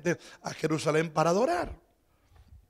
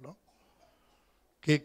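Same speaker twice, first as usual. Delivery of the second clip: normal talking voice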